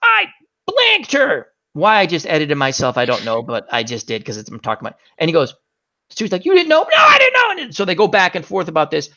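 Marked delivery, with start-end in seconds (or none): 0.0-1.5 high, slow agonized wail; mock-horrified high confession; Brian. 6.4-7.6 high-pitched incredulous shouting; cartoon squabble, very high voice; acted heated back-and-forth. Acted argument between Stewie and Brian.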